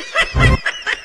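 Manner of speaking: loud high burst of laughter. Real, not acted. Host laughs at joke.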